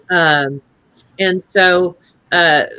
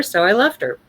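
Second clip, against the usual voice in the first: ordinary delivery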